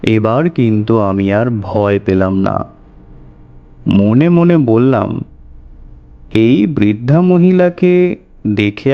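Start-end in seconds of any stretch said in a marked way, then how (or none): none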